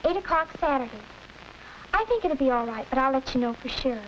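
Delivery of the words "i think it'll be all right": ordinary delivery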